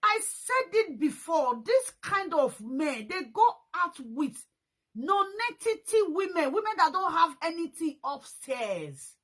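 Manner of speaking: indignant lecture on such men; heated rant. Real, not acted. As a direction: hammer each word out slowly.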